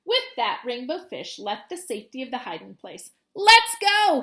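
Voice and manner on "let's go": loud, high rallying call; rally the school with a shout. Acted. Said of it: "he called".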